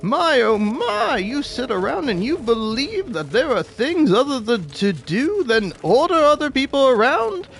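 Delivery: theatrical talk-for-a-living blowhard; hammed-up pompous boss. Shown as a demonstration. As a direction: dismiss a lowly laborer with mock outrage. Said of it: "I want you to feel like talking is".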